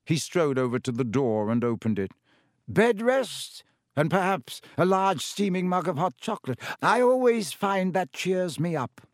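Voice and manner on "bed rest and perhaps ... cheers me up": lightly voiced kindly old wizard, higher; Dumbledore. A narrator's reading. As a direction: offer comfort like a twinkling grandfather.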